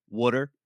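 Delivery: "water" as WOODER; Philly pronunciation of water. Shown as a demonstration. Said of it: "if you say it like that".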